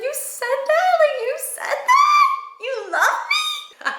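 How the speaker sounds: very high, slow gushing disbelief; high, drawn-out delighted squeal; stunned squeal. Retold or imitated. Her stunned reaction retold.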